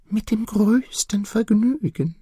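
high whispered bird voice; nightingale. Acted. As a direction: answer like a tiny bird, breath only. Nightingale answers.

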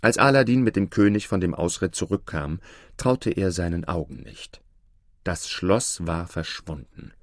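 plain reading voice

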